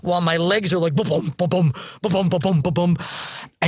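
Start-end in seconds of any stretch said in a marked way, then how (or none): 0.9-3.0 rhythmic galloping noise; imitated hoofbeats. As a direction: bounce out mock hoofbeats. Mimics legs galloping as a horse.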